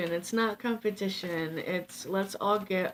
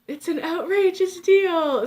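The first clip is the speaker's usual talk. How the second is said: infomercial voice; 10 semitones higher, 9 dB louder than usual. Acted. Continuing the sales pitch.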